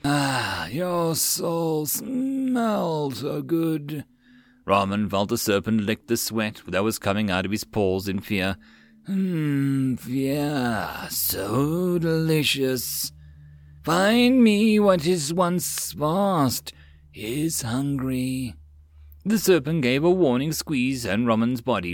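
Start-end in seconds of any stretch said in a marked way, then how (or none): 0.0-4.0 very slow, savoring serpent voice; a snake relishing its prey; hissing serpent. Acted. Serpent savoring his soul. 9.0-18.5 very slow, savoring serpent voice; a snake relishing its prey; hissing serpent. Acted.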